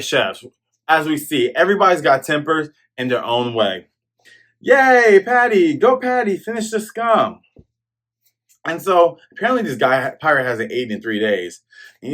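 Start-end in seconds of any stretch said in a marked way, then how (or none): 4.6-7.4 high, loud mock cheering; hammed-up cheer for Patty. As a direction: egg him on like a rowdy crowd.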